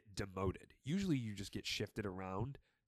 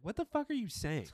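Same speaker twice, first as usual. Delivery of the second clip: baffled; 11 semitones higher than usual. Retold or imitated. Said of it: "I'm like".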